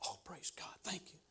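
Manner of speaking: hushed praise. Retold or imitated. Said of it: "people were saying".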